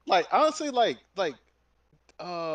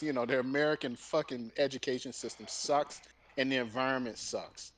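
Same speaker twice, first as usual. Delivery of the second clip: usual speaking voice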